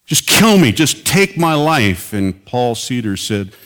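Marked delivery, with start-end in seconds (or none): 0.0-2.1 rising anguished plea, slow and pleading; desperate cry to God. Retold or imitated. Minister crying out to God in his car, anguished plea. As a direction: beg God to end it, voice cracking upward. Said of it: "He just blurted out".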